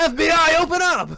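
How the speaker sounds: high, loud cop bark; mock police raid. Jokes that police should raid over the minor, mock-yelling. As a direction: pound the door like a joking federal agent. Joking about the underage girl.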